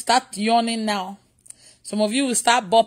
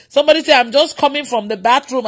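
ordinary talk

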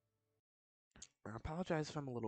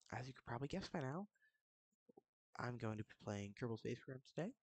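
ordinary talk, nothing marked

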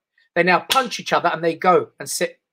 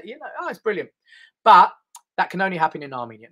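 ordinary talk, nothing marked

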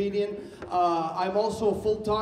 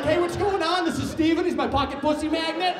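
loud intro; 9 semitones higher, 4 dB louder than usual. Retold or imitated. Introducing friend on date.

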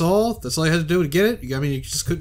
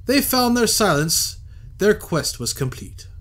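higher, lilting recital of the verse; poem reading; 6 semitones higher than usual. Acted. Player reading a strange poem off the page, recited. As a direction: read the poem like a little performance. Reads found poem aloud.

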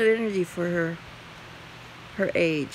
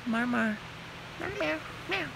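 imitating a cat's meow, high and soft at the end; meowing back at the cat playfully; mock meow; 5 semitones higher, 8 dB quieter than usual. Owner meowing at her cat, playful mock meow.

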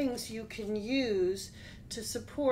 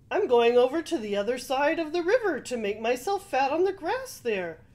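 quick, higher goat explaining; 5 semitones higher, 7 dB louder, faster than usual. Acted. Middle goat tells the troll his plan, quick and higher. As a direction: rattle it off as the eager goat.

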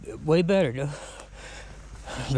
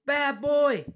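higher pitched mock-stern scolding of a pet; scolding the dog; 7 semitones higher than usual. Real, not acted. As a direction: tell the dog off in a lifted, playful voice.

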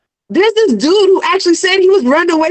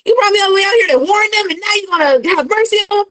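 fast, high-pitched exasperated complaint; indignant rant, rushed and pitched up; mock angry outburst; 6 semitones higher, faster than usual. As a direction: act out someone griping furiously at God. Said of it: "He was mad".

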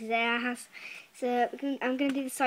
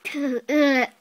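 loud laugh; 2 semitones higher, 10 dB louder than usual. Real, not acted. After tasting.

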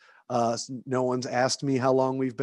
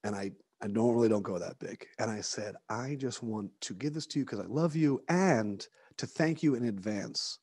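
hushed low voice, kept down; whispering; 3 semitones lower, 7 dB quieter than usual. Real, not acted. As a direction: keep it down so the next room can't hear. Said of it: "I have to whisper 'cause she's in the other room".

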